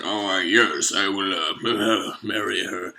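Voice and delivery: put-on low, slow, quiet old voice; low old mole agreeing to marry. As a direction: drone it like a creaky old rich man.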